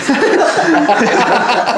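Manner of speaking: loud, high-pitched laugh; big real laugh; real burst of laughter. Real, not acted. Group laughs at interjection.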